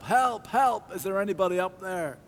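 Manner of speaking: high calls up the cliff for help; a man dangling from a cliff calls for help, high and strained; calling for help. A narrator's reading. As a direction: cry out upward to be heard. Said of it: "to shout out for help".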